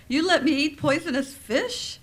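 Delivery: loud, high, shocked accusation; shocked wife turns on her husband; alarmed outburst. Retold or imitated. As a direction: hit it with sudden alarm at the husband. Wife learns she ate poison.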